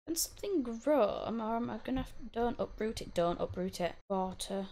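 ordinary talk, nothing marked

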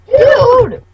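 high, loud exasperated shout; exasperated yell. Real, not acted. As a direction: yell it at your friend in disbelief. Yells at friend eating pole.

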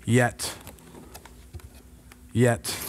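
low "yet", repeated with long silences; quiet, low, reassuring scripture close; solemn promise. Real, not acted. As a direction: let each word settle before the next. Slow closing scripture promise.

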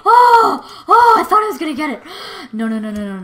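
frantic high cries of no; panicked shouting near death. Real, not acted. Nearly killed in the game.

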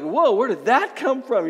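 a loud, startled whoa; mock surprise. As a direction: act shocked at your own thought. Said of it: "they're just like".

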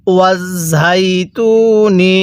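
ওয়াজ ঝাই তু নি with held ঝাই and long তু; drawn-out recitation. Shown as a demonstration. Teacher shows learners how to read, drawn-out ওয়াজ ঝাই তু নি. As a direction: stretch ঝাই and তু evenly. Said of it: "পড়ুন আমার সাথে".

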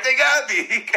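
high, pained little "They got me."; mock wounded whimper. Retelling a bloodied guy, high mock whimper. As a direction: imitate a beaten man moaning "They got me". Imitating the beaten man.

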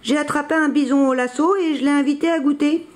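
high-pitched, proud announcement. A narrator's reading. Billy tells his papa about the catch, proudly. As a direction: report your feat proudly to your father. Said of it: "dit Billy fièrement".

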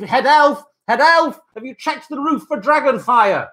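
calling out. Real, not acted. Calling to head elf.

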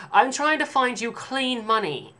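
high, slow, loud snooty clerk; mock a fussy stuck-up cashier; snobby saleswoman. Snobby cashier holding up the customer's change. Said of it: "and she's like".

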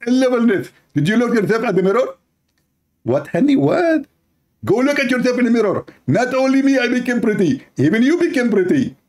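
high, excited wife voice; gushing wife. Acted. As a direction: rush it out, thrilled with her looks. Wife gushes about beauty.

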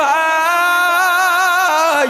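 high, loud, very drawn-out cry; loud slow pleading call; impassioned plea. Acted. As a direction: cry out to the young man to repent.

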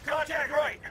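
urgent high-pitched contact callout; combat shout. Acted. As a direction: yell it over gunfire. Combat callout under fire.

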